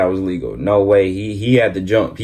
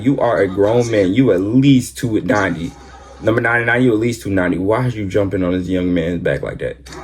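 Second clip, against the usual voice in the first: ordinary delivery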